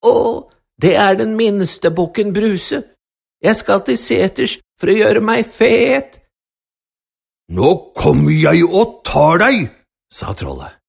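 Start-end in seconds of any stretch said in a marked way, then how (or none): 0.0-6.2 little goat voice. Acted. Smallest goat answers the troll. 7.5-9.7 loud, menacing troll threat. Acted. Troll threatens the little goat.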